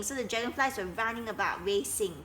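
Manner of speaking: plain reading voice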